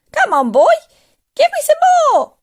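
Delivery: loud screechy demand, rising very high; bossy old woman hollering; impatient yell. A narrator's reading. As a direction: yell to hurry the boy up. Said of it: "she yelled".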